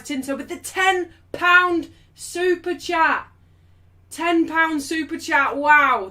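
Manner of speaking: loud, high, slowly stretched hype; excited announcement. Real, not acted. Streamer celebrating a ten pound super chat, excited announcement. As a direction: draw out the amount in amazement.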